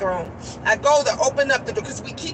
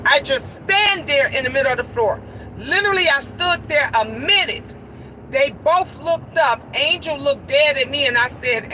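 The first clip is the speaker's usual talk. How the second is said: normal talking voice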